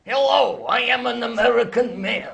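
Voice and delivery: high, loud hammed-up American accent; hammy American man voice, loud and high. Shown as a demonstration. Asked for an American accent, loud hammy American. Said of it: "Can you put on one?".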